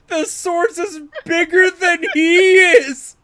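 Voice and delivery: high, loud shriek of disbelief; incredulous outburst. Real, not acted. Astonished at gnome's huge sword.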